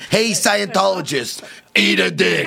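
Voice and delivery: slow New Jersey tough guy; Jersey accent. Pretend. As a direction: drawl it like a Jersey wiseguy. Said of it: "in a Jersey accent".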